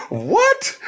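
high squeaky mock-shocked what. Guest retelling counselor talk, in a high shocked yelp. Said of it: "And I was like".

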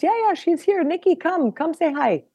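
high-pitched imitation of mom calling. Mom calling her to the phone.

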